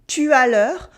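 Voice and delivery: "Tu as l'heure" with the voice going up on "l'heure"; rising question intonation. Shown as a demonstration. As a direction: turn "Tu as l'heure" into a question by pitch alone. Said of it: "my voice goes up".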